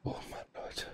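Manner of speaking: hushed whisper. Real, not acted. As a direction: whisper it, hiding. Hiding from enemy.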